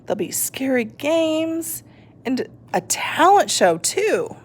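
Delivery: lightly voiced eager skeleton; a hint of an excited skeleton. A narrator's reading.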